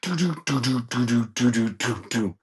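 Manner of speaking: punchy vocal drum fill; pounding "do-do" drum imitation; imitated drum solo. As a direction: mouth the big drum break. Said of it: "that drum solo just comes in".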